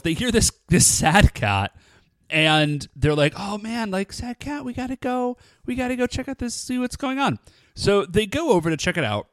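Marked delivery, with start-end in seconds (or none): 3.4-7.4 put-on higher, softer little girl voice. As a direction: coo it softly like a worried girl. Retelling two little girls' reaction.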